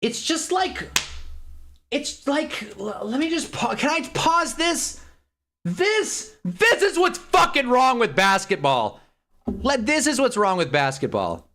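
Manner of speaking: high, strained ranting voice; rising rant. Real, not acted. Streamer ranting at chat, voice climbing high. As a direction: wind up into a fed-up rant. Escalating rant about basketball.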